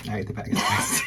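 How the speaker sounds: breathy laughing admission of eating the Twix; guilty laughing confession. Real, not acted. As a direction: laugh it out, caught red-handed. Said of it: "I thought we were talking about something else".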